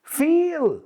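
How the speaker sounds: put-on accented 'Phil' that sounds like 'feel'; Gloria. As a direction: stretch 'Phil' into a long, high 'Feeel'. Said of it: "she walks in the door and she says".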